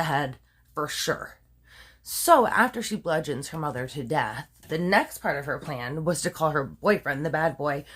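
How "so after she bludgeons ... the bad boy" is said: normal talking voice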